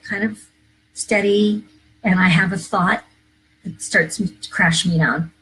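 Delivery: ordinary delivery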